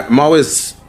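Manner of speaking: usual speaking voice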